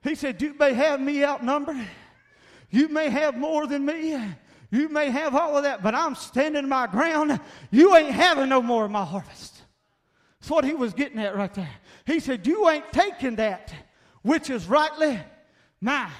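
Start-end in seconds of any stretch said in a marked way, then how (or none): 0.4-9.6 loud, high preacher's cry; a preacher shouting defiance; fiery preaching. Acted. Preaching at full force. 12.5-16.1 louder, higher defiant declaration; a preacher voicing a defiant farmer; defiant quote. Quoting defiance to Philistines.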